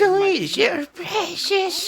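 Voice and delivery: high, slow creepy creature voice. Acted. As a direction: croon it like a hoarding goblin. Creepy voice mocking the cat.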